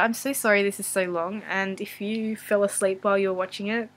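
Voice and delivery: usual speaking voice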